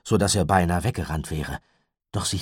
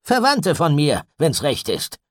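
irritated snap; 10 semitones higher, 8 dB louder than usual. A narrator's reading. Gideon snaps at the mother, irritated. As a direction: snap it back, annoyed. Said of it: "mit seiner üblichen raschen Gereiztheit".

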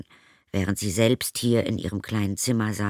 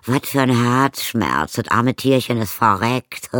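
louder, slow fake sorrow; mock sympathy; 4 semitones higher, 7 dB louder than usual. Stepmother fakes grief over the dead hamster, hammed up. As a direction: overplay the pity, no real feeling.

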